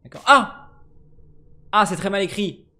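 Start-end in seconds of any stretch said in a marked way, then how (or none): 0.3-0.7 sudden high startled cry; startled yelp. Real, not acted. Gamer startled by something on screen, sharp yelp. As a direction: jump at a scare and yelp. Startled while playing horror game.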